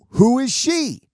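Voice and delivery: high, loud, pointed question; sceptical challenge. Retold or imitated. Questioning the girl about her boss, sceptical. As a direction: challenge the source, acted out. Retold challenge to the girl's source.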